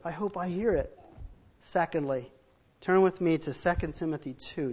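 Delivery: ordinary talk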